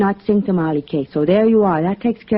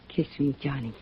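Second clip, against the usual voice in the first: softer, low invitation; seductive; 5 semitones lower, 11 dB quieter than usual. Acted. Lou inviting Johnny to kiss her, soft and seductive. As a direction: draw him in close and soft.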